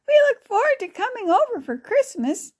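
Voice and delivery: put-on high-pitched, eager child voice; eager girl. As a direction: sound like a girl looking forward to a visit. Child character looks forward to Christmas.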